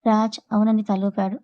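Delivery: plain reading voice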